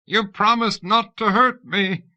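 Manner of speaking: high, loud frightened scream; terrified scream. A narrator's reading. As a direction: scream it in panic. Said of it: "Westfall screamed".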